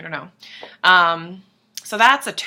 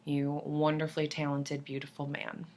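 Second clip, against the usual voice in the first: low, soft, reverent murmur; emotional awe; 4 semitones lower, 10 dB quieter than usual. Acted. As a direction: say it hushed, overcome with admiration. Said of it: "I get really emotional".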